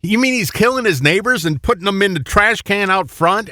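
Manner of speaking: high-pitched questioning quote; mild put-on disbelief; mock incredulous question. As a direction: play a local double-checking the gossip. Acts out locals questioning the story.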